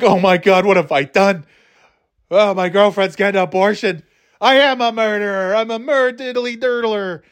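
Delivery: high, hammed-up guilty panic; mocking the movie guy's horror at being a murderer. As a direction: wail it like a cartoon sinner.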